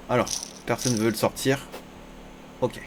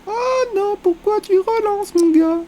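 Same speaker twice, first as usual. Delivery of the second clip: squeaky high complaint, much higher; high-pitched whine; 4 dB louder, slower than usual. Real, not acted. Streamer whining in a high voice at an opponent's raise. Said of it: "j'ai une voix, une voix aiguë comme ça".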